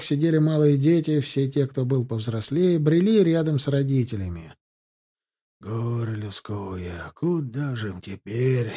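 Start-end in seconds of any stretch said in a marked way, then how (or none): 5.6-8.7 slow, low, subdued lament. A narrator's reading. Dragoons pitying burned-out refugees, sorrowful lament. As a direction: softly bemoan it, taking your time. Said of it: "сетовали драгуны".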